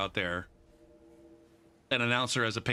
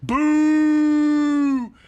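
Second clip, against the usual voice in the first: loud, high drawn-out boo; crowd booing; 13 semitones higher, 11 dB louder than usual. Retold or imitated. Whole stadium booing the little Patriots girl, loud boo. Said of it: "then it was".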